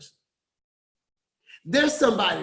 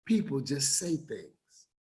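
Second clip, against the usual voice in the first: low, much softer afterthought; quiet; 7 semitones lower, 9 dB quieter than usual. Real, not acted. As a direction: drop to a low, soft aside. Soft punchline after emphatic lines.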